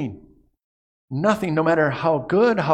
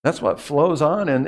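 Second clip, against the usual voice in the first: usual speaking voice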